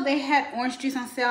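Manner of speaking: usual speaking voice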